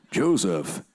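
a neighbour's deep call across the way. Retold or imitated. Voicing a neighbour calling Joseph.

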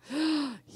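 sharp high mock-shocked gasp. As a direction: gasp as if scandalized. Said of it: "Yes, I said the O word in church".

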